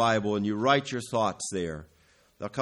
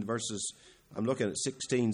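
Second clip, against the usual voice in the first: ordinary talk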